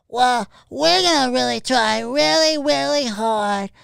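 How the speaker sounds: high, slow mock kiddie voice; hammed-up childish drawl; Pee-wee voice. Streamer imitates Pee-wee lines, high and slow. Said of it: "the whole".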